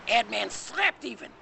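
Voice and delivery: usual speaking voice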